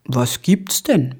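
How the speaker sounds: slow, mildly puzzled question; hesitant, understated bafflement; a little at a loss. A narrator's reading. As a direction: ask slowly, not quite sure. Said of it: "ein wenig ratlos".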